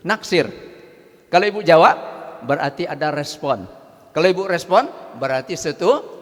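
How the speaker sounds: usual speaking voice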